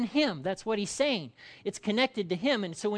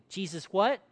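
ordinary delivery

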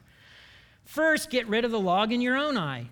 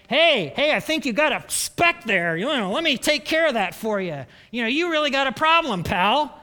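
loud, higher, pushy busybody offering help; mock helpful bloke; 6 semitones higher, 7 dB louder than usual. Bloke with a plank in his eye offers help, pushy and loud. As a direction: barge in like a nosy know-it-all. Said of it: "and he's like".

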